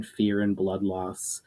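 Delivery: ordinary delivery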